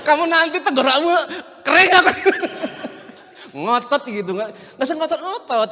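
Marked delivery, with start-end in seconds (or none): 0.0-2.6 loud, high, laughing warning. Real, not acted. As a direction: tease the shouters through laughter. Jokes about shouting young pastors.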